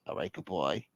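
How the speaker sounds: low, very soft sign-off; hushed, acted phone goodbye; subdued goodbye. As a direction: mumble a sulky farewell. Caller's first subdued goodbye.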